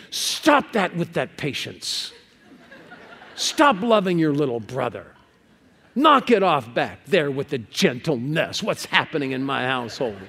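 mock-stern dad telling off the kids; scolding dad. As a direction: bark it like a dad at the wheel. Acting out Dad scolding in car.

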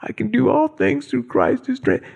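put-on high, small child's voice; a little girl carefully repeating her grandpa's verse; high little-girl recitation. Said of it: "I want you to say that now".

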